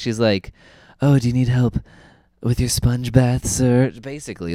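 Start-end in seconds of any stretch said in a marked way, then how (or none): 1.0-3.9 lightly voiced flirty nurse; hammed-up come-on, lingering over it; mock seductive. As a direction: playfully offer help as a seductive nurse. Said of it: "deep, seductive voice".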